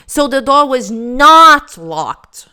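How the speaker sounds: "not" in "So the door was not locked" given loud stress; slow and loud, landing on "not". Shown as a demonstration. Teacher pointing out the man's emphasis, slow and loud. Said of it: "ele dá ênfase aqui".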